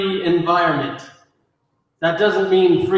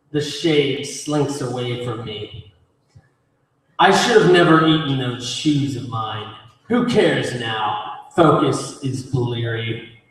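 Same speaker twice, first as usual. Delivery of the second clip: low, grim poem reading with pauses; poetry recitation; 4 semitones lower than usual. Acted. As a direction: recite it low and measured, line by line. Performs own poem.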